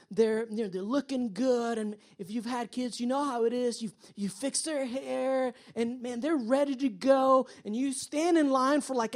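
ordinary delivery

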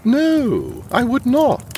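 high, emphatic, affronted refusal; indignant denial. Acted. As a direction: deny it indignantly with a jump in pitch. Indignant denial of abuse allegation.

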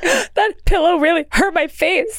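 high-pitched playful complaint; mock hurt child. Joking as the beaten child.

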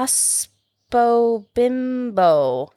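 hospo bimbo drawn out very slowly, oddly; slow mispronouncing. Retold or imitated. Mocking a man reading her name on a live, slow. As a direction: imitate a stranger reading it slowly. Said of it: "He's like".

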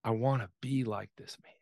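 low, whispered thought; inner thought. Retold or imitated. Boy thinking in his head about dad, whispered. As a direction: whisper it as a private wish. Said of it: "I remember thinking in myself, in my head".

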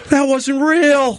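relieved sigh. Retold or imitated. Relief on waking.

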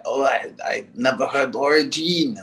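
a light put-on French accent; fake French accent. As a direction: speak it like a mock French fan. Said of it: "now I'm speaking like I'm fake French".

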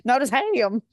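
teasing echo with "Ham" stretched and swooping down; mimicking odd emphasis. Co-host mimics the host's slip, exaggerated Ham. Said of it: "why I said it like that".

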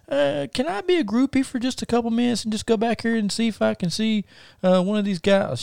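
mock high falsetto wife voice; high, pleading wife impersonation; imitating wife. Retold wife asking permission.

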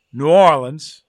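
New Orleans run into one word; local pronunciation. Shown as a demonstration. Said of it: "two correct pronunciations".

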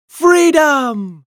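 high, loud, drawn-out cry; loud jubilant shout. A narrator's reading. Polly skips around celebrating her brother's leaving, loudly shouting. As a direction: shout it out long and high, falling away. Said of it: "loudly shouting".

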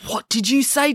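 put-on angry girl confronting the boy; demanding. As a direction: challenge him, ready to fight. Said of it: "demanded April".

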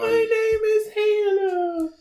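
very high, slow ghostly voice; creepy ghost voice. Pretend. Friend voicing the creepy dream voice, very high and slow. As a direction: sing it like a haunting child.

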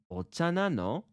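"ocha nano" pitched high with a final rise; casual question tune. Shown as a demonstration. Teacher modelling a casual tea question, "nano" rising. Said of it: "it would go like this".

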